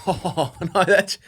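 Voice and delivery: high-pitched delight through laughter; earnest, laughing protest. Real, not acted. Co-host swears the praise isn't sarcastic, laughing in earnest. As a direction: insist you mean it while laughing, voice climbing high. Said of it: "I'm being serious".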